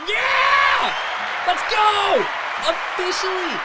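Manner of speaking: loud, high celebratory yell; excited cheering. Real, not acted. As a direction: cheer it out, hyped. Celebrating sponsorship, applause cue.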